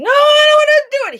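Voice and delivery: put-on high-pitched frightened child's scream, loud; imitating a screaming kid. Terrified boy refusing the dinosaur photo, high scream. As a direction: shriek it like a terrified ten-year-old. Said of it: "he's screaming".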